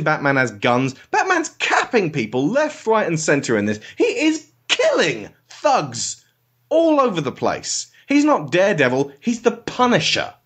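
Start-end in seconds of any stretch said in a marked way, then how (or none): 1.1-10.3 pitched way up in outraged disbelief; incredulous rant. Real, not acted. To the co-host about violent Batman, high incredulous rant. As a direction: squeal it out, appalled.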